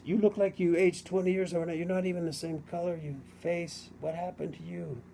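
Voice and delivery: imitating a horrified shop clerk; scared clerk. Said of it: "He was scared".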